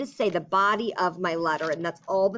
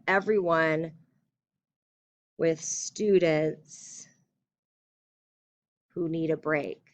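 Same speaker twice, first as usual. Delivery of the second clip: slow, drawn-out dictation; 2 semitones lower, slower than usual. Shown as a demonstration. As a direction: spell it out as you write. Teacher dictates letter aloud.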